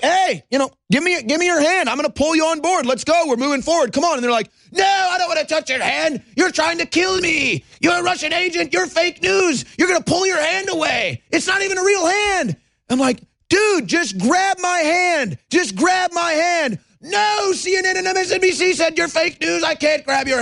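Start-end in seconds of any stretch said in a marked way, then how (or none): 0.0-4.1 high, insistent rescuer's plea; urgent rescuer. Acted. Acts out urging drowning people aboard. 4.7-12.6 high, hammed-up hysterical refusal; mock panic. Voices panicked drowning people refusing help. 13.5-16.8 slow, high, drawn-out begging; exasperated plea. Acted. Acts out pleading with drowning people.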